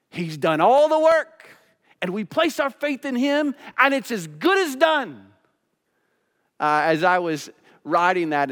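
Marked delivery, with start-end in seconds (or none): 0.1-5.2 loud, high preaching with punched key words; urgent pulpit delivery, voice lifted high; impassioned preaching. Acted. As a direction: plead with the congregation at full force. Preaches at full force.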